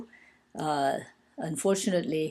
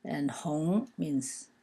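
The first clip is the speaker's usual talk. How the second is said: ordinary talk, nothing marked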